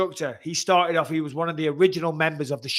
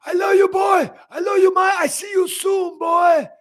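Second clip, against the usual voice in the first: put-on much higher, loud shouting Cuban-accented Romero voice; Yoel Romero impression; 8 dB louder than usual. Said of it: "something to do with Yoel Romero".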